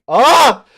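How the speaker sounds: frustrated yell. Real, not acted. Frustrated at failed accent.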